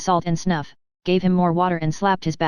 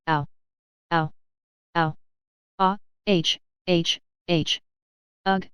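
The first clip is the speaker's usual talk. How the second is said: choking cries and crowing; slower than usual. A narrator's reading. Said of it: "crowing fearfully".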